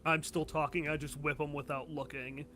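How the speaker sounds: ordinary talk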